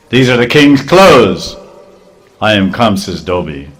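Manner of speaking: loud, haughty washerman; indignant servant. Acted. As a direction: puff up and put the boys in their place. Washerman rebukes the boys.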